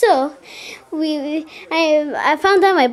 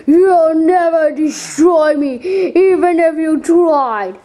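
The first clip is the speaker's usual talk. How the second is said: slow defiant character voice; 2 semitones higher, slower than usual. Pretend. Defiant reply in toy play.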